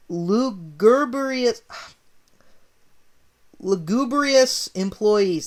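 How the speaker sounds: lugubrious stretched very slow and high; drawn-out hard word. Shown as a demonstration. Reader stumbling on a big word, drawn-out lugubrious. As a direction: sound out lugubrious slowly, syllable by syllable. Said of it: "That's the best take I'm doing".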